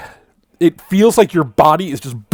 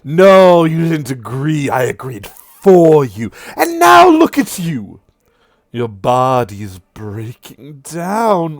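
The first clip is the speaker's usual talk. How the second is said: mocking devil; 2 semitones higher, 6 dB louder, slower than usual. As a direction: sneer at him with relish. Sirik continues.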